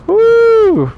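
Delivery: high, loud whoop; loud high-pitched cheer; excited woo. Real, not acted. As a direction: whoop with delight. Hyped reacting to chat.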